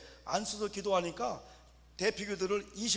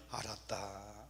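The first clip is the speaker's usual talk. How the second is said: low, softer mild voice of God; God answers; 8 semitones lower, 9 dB quieter, much slower than usual. Retold or imitated. God answers a praying believer, low and mild. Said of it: "하면 하나님이".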